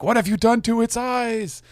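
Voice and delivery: high-pitched imitated shriek of horror; horrified movie mother. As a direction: quote the film in mock hysteria. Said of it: "when she's like".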